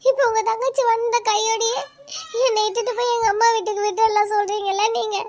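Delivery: high, loud, tearful reproach; crying complaint. Acted. Wife crying at her husband about his sister staying. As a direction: pour out the hurt while crying. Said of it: "இப்போ எதுக்குடி அழுதுட்டு இருக்க?".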